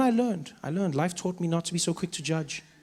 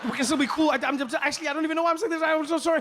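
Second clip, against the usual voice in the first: flustered, higher babbling apology; frazzled; 7 semitones higher, faster than usual. Retold or imitated. Trevor to the president after a gaffe, frazzled. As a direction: gush apologies in a climbing panic. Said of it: "I'm frazzled and I'm like".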